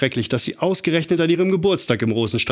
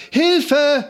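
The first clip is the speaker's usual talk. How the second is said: very high, louder cry for help; screamed for help; 4 dB louder than usual. A narrator's reading. Lotta on the runaway bike screams for help. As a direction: shriek it as you crash down the hill. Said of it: "schrie Lotta".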